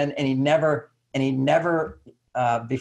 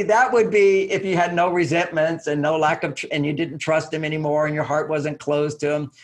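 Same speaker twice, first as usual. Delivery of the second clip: ordinary talk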